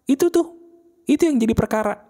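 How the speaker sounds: usual speaking voice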